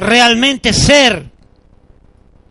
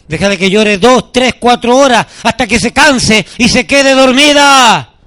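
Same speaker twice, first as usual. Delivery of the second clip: emphatic advice. Acted. Pastor telling the baby's father to let her cry, emphatic. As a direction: insist on it, counting out each number firmly. Same advice repeated, building loud.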